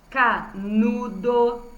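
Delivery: "canudo" drawn out as ca-nu-do; syllable by syllable. Shown as a demonstration. Teacher showing pupils the middle syllable, "canudo" syllable by syllable. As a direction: break "canudo" into slow, separate syllables. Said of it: "Reparem que as sílabas com N".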